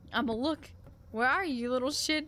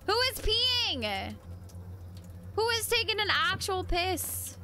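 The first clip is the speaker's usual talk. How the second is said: higher-pitched incredulous question; baffled, insistent question; 7 semitones higher than usual. Real, not acted. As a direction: ask it in high disbelief. Baffled question repeated, more insistent.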